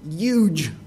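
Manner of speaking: put-on "huge" as "yuge" in a New York accent. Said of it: "in his New York accent".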